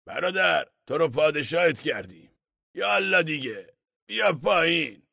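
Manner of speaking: loud calls up to the roof; shouting crowd. A narrator's reading. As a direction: yell up at the man on the roof. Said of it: "از پایین فریاد زنان گفتند".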